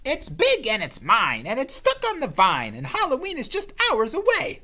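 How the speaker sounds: high, loud possessive witch. Acted. Witch warning off the ghost, possessive. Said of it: "said the witch".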